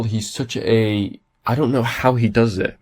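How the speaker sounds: ordinary talk, nothing marked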